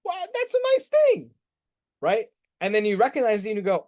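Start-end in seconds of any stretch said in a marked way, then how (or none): 0.1-1.3 sing-song high aside. Acted. Mock-sweet high aside.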